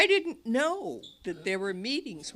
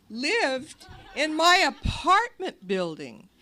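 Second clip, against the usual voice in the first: ordinary delivery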